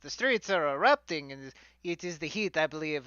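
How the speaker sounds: hammed-up fantasy Italian accent; bad stage Italian for a fantasy character; fake Italian accent. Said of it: "bad, fake fantasy Italian accent".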